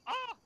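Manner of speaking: loud, piercingly high mock squeal; imitation of a startled high shriek; squeaky yelp. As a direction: mimic a silly squeak of fright. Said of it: "You don't go".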